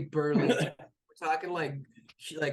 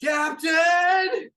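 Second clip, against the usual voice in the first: high, full-voiced yell; alarmed shout; 11 dB louder than usual. Sailor to captain as creature climbs aboard, alarmed shout. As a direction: holler it long and high.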